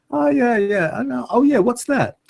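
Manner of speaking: high, squeaky duck-person voice; duck ambassador voice. Pretend. Duck ambassador browsing Facebook photos, high and chirpy. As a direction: play a curious alien duck ambassador.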